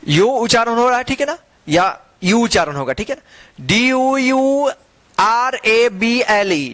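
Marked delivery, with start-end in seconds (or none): 3.7-6.7 slow spelling out. Shown as a demonstration. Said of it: "spelling out letters slowly".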